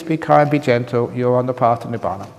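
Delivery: normal talking voice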